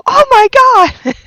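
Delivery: high, loud startled "oh my God"; shocked yelp. Retold or imitated. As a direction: blurt it out in sudden alarm. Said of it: "I'm like".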